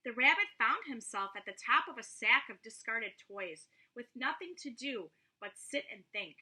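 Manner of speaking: plain reading voice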